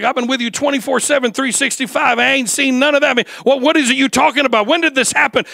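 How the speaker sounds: indignant disciple who saw nothing; Peter. Retold or imitated. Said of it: "I can almost hear Peter".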